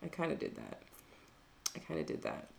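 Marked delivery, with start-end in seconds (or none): 1.8-2.5 breathy whispered aside, much softer. Real, not acted. Admitting to her co-host she muscled in, in a whisper. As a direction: drop to a sheepish whisper.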